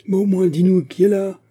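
high, loud angry demand; enraged collective outcry; furious shout. A narrator's reading. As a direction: shout for an answer, full force. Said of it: "s'écrièrent plusieurs voix furieuses".

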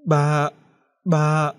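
put-on a scared girl stammering at her father. A narrator's reading. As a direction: back away stammering his name. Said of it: "Cô lùi lại lắp bắp".

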